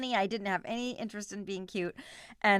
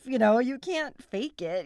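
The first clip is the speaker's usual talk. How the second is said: ordinary talk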